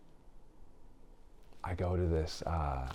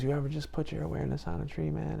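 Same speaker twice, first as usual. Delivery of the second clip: lightly voiced cool dude, higher and fast; laid-back cool dude; 8 semitones higher, 4 dB quieter, faster than usual. Quoting the friend on the phone, a hint of easygoing dude. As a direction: rattle it off like a mellow buddy, pitched up. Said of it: "he talks like that".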